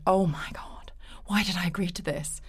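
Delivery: whispered inner panic; hushed anxious aside to herself; panicked whisper. Retold or imitated. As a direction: breathe it out like a secret worry. Panicked thoughts back at work.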